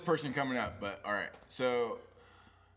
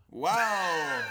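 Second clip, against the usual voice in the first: retold wow; 4 semitones higher, 5 dB louder than usual. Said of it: "I was just like".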